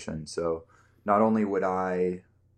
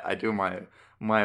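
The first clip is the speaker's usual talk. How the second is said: ordinary talk, nothing marked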